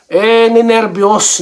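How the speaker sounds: slow amused "ninervyos"; slow knowing drawl. Real, not acted. Explaining players' nerves.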